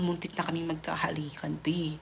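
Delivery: hushed whisper, as if sharing a secret. Real, not acted. Whispering to her viewer about the near kiss, whisper. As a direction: drop to a whisper for the juicy part.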